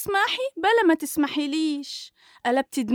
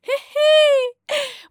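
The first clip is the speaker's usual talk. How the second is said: loud shrieking mocking laugh; 11 semitones higher, 6 dB louder than usual. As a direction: laugh right in her face.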